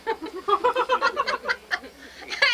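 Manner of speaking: loud high burst of laughter. Real, not acted. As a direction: laugh out loud at your own joke. Laughing at own joke.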